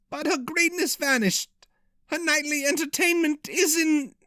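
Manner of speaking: croaking voice. A narrator's reading. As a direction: croak it out, nervous and queasy. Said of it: "had a croaking voice".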